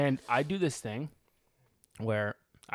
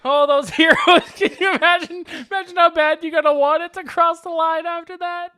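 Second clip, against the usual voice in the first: laughing mock awe; 13 semitones higher, 10 dB louder than usual. Mock praise of heroes, laughing.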